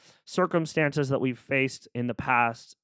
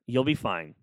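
usual speaking voice

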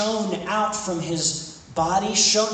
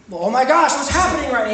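higher and louder, babbling in a panic; flustered Peter; 5 semitones higher, 5 dB louder, faster than usual. Acted. Peter panicking to Jesus at the mountaintop, higher and louder. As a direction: blurt it out like an overwhelmed disciple.

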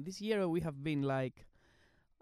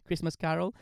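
ordinary delivery

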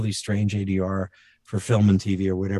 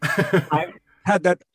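higher, halting monologue impression; Christopher Walken; 4 semitones higher, slower than usual. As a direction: chop it up with odd gaps. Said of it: "Christopher Walken doing that".

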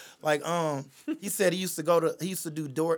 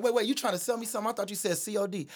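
quick high Jamie; 6 semitones higher, faster than usual. Retold or imitated. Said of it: "He's like".

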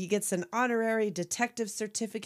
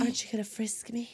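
lightly voiced sultry femme fatale, a bit quieter; a hint of a seductress teasing; Jessica Rabbit; 2 semitones higher, 4 dB quieter than usual. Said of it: "So Jessica Rabbit".

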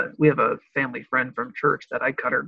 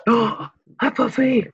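high, bright hello to a dog; excited greeting; 7 semitones higher, 7 dB louder, slower than usual. Real, not acted. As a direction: greet the puppy with delight.

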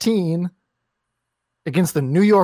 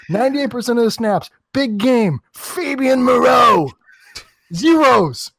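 furious yelling rant; 4 semitones higher than usual. Real, not acted. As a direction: rant at the top of his voice. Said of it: "Blowing out our audio out here".

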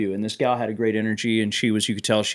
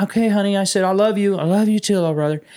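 raised, gentle loving voice; tender; 7 semitones higher, faster than usual. Retold or imitated. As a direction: soothe her kindly. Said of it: "I just went".

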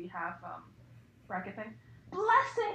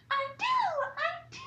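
put-on much higher, squealed child's yes; squealing; 4 dB louder, faster than usual. Harry answering Hagrid, high squealing child voice. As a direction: squeal with eager joy. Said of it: "Harry squealed".

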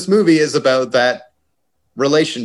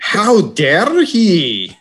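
put-on very slow, high, loud Irish accent, laughing through it; hammed-up Irish brogue, drawn out and laughing; Irish Harrison Ford; 9 semitones higher, 5 dB louder, much slower than usual. Podcaster doing Harrison Ford in a mock Irish accent, laughing. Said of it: "I'll do an Irish Harrison Ford".

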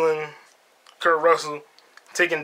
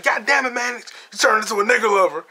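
much higher put-on voice, mock outraged; Daisy scolding; 6 semitones higher than usual. Imitating Daisy berating Mannix, higher mock outrage. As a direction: screech out Daisy's insult. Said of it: "And she's like".

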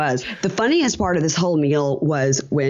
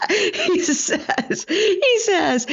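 higher voice while laughing through the words; much slower than usual. Real, not acted. Host laughing while quoting Pedro, high. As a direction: laugh helplessly as you quote it.

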